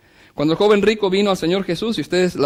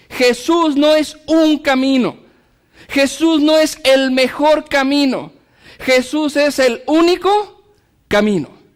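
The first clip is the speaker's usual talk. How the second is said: slow, emphatic sermon build; fervent preaching; 7 semitones higher, 4 dB louder, slower than usual. Acted. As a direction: hammer each phrase slowly. Preaching at full force.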